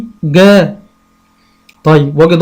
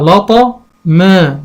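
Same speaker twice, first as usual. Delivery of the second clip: لطا ما drawn out slowly in two parts; slow blending; much slower than usual. Shown as a demonstration. Teacher blending syllables for a child, slowly. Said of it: "هقول إيه".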